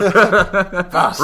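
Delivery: loud, high, laughing curse; laughing mock insult. Real, not acted. Co-host jokingly curses the spoiler, laughing. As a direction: throw it at him through laughter.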